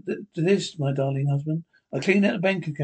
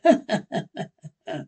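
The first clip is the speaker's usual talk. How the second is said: a short scornful laugh, pitched up; mocking laugh; 4 semitones higher than usual. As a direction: laugh in his face. [name] laughs at John.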